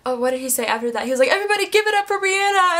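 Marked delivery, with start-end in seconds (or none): 1.3-2.8 high-pitched imitation of a crowd call; retold emcee shout-out; stage hype. Imitating band member hyping the crowd.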